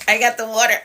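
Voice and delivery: giggling through the words; laughing. Real, not acted. Laughs at own coughing fit.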